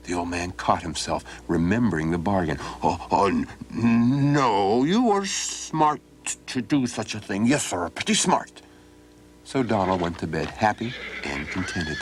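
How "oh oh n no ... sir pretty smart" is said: put-on flustered old man. Old man stammering over bargain.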